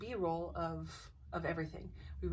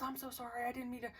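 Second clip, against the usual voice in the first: higher, softer imitated apology; apologetic; 5 semitones higher, 4 dB quieter, faster than usual. Friend apologizes for leaving her behind, lightly imitated sheepishness. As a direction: lightly mimic a friend backpedalling.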